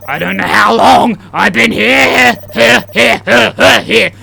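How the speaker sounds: loud, high, building to repeated cries; escalating panic. Acted. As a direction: let the last word burst out repeatedly. Repeated line escalates into shouting.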